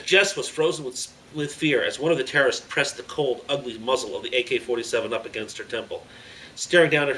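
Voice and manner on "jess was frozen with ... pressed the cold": usual speaking voice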